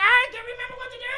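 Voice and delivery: high, rushed, helpless gabble; flustered babble. Retold or imitated. Flustered dad confessing to his wife, high and rushed. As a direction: spill it out fast and helpless.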